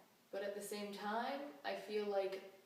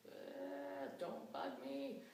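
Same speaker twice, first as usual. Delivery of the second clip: higher, drawn-out grumble; mildly irritable, softer and slow, waving someone off; mock irritability; 7 semitones higher, 5 dB quieter, much slower than usual. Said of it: "the irritability happens".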